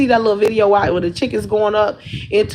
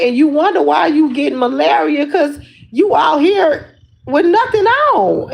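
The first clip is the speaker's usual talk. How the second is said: teasing scold; 6 semitones higher than usual. Retold or imitated. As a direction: scold him like a fussing wife. Scolding boyfriend about mosquitoes.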